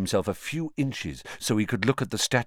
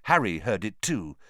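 plain reading voice

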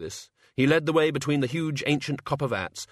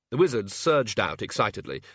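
usual speaking voice

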